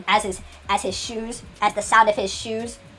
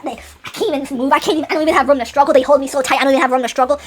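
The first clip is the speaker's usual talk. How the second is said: struggling while pinned; 5 semitones higher, 5 dB louder, faster than usual. Acted. Acting out being pinned down.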